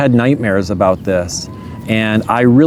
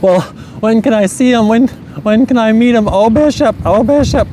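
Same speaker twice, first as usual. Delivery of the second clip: much higher, breathless mock mother; imitating eager mom; 9 semitones higher than usual. Son retells mom eagerly asking to meet grandson, in a much higher voice. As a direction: pitch way up, begging to see the baby.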